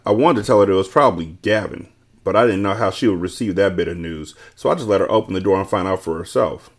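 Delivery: ordinary delivery, nothing marked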